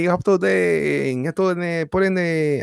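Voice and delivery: mock Spanish-accented greeting; imitating Henry.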